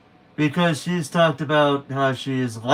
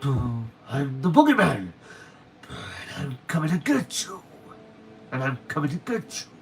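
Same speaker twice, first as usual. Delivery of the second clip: hushed spooky monster voice; playful horror-villain menace, trailing softly; Boogeyman; 4 dB quieter than usual. Acted. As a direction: creep it out like a bogeyman coming for someone. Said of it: "this Boogeyman".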